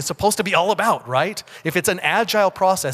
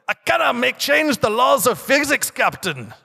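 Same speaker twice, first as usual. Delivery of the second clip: Montgomery Scott in a hammed-up, higher Scottish burr, badly faked; a hammy, higher Scottish engineer voice; bad Scottish accent; 8 semitones higher than usual. Quoting Montgomery Scott to his captain in a bad Scottish accent. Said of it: "That was a really bad Scottish accent".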